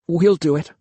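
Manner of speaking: plain reading voice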